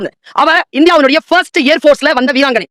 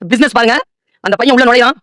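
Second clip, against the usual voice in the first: ordinary delivery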